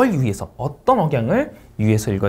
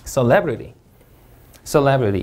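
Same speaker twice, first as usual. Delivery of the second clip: "celebrity" with LEB stressed; ce-LEB-rity, falling away after "celebrity" peaks on LEB; second-syllable stress; much slower than usual. Shown as a demonstration. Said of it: "이음절 강세".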